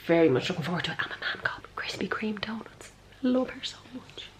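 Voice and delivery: hushed, breathy delight; excited whisper. Real, not acted. Telling viewers about mum's donuts in a giddy whisper. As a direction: whisper it like a secret treat.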